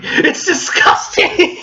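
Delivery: high, slow squealing outrage; outraged high squeal. Acted. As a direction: squeal it out in disgust. Outrage at dairy fridge.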